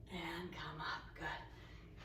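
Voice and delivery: soft unvoiced encouragement; whispered cue. Real, not acted. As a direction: breathe the words out. Coach softly cueing movement.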